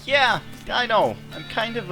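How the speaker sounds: squeaky high laugh-talk; giggling through the words. Real, not acted.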